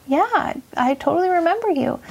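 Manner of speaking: lightly acted glad reply; friendly recognition. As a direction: pitch up as if recognizing an old patient. Retold reply to returning patient.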